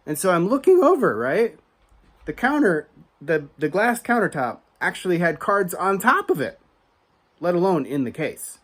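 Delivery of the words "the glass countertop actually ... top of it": ordinary delivery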